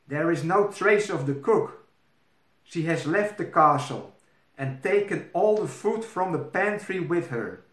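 urgent old maid breaking bad news; worried old maid.

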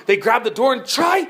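loud, high push on the door; straining effort. A narrator's reading. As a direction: heave it open with effort. Children straining at locked door.

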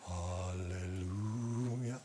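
very slow, low, soft hallelujah; serene praise. Acted. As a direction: breathe out a calm, trusting praise. Paul calmly praises instead. (langsames, leises, ruhiges Halleluja)

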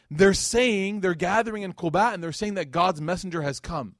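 excited announcement. Retold or imitated. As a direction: relay momentous news with urgency. Cousin announcing the messenger's arrival.

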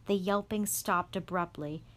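plain reading voice

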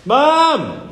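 high, full-voiced yell. A narrator's reading. Boy calling his mother after no reply, shouting hard. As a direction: holler it with all your might. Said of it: "he shouted louder".

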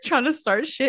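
ordinary delivery

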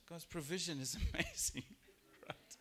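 laughs in the middle of the line; laughing. Real, not acted. Preacher marvelling at God's provision, laughing mid-line. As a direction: let a laugh break into amazing.

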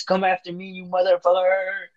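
hammed-up desperate challenge; mock taunt. Voicing injured girl taunting killer.

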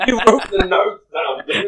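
high, light woman's voice. Acted. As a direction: pitch it up as the lady. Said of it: "do a woman's accent".

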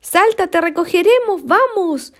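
high, loud calling out; friends shouting. Acted. Friends shouting up to Kevin to jump: high loud calls. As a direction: yell up to him to let go. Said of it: "los amigos comenzaron a gritar".

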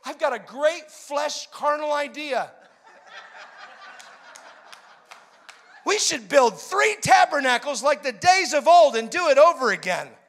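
very high, loud, frantic shouting; freaking out. Acted. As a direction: burst with overexcited glee. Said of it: "Peter was freaking out".

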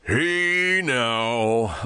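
slow, smooth late-night radio host; rich radio voice. Pretend. Host admiring his own voice on air, rich radio voice. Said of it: "there's that fucking rich voice".

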